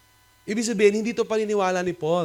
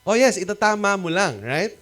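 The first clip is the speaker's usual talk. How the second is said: usual speaking voice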